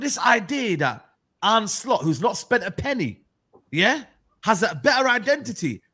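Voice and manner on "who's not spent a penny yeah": normal talking voice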